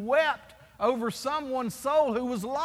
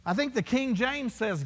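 ordinary delivery

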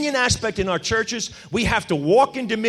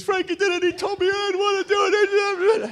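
tattling little brother; 10 semitones higher than usual. Retold or imitated. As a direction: squeal on your big brother like a small child. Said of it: "George spilled the beans".

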